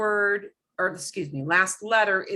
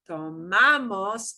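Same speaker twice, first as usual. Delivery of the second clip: tomamos slowly, with MA stretched and punched; stress on second-to-last syllable; slower than usual. Shown as a demonstration. Teacher showing the second to last, slow stressed MA in tomamos. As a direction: lean long on the MA of tomamos. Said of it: "There's the second to last".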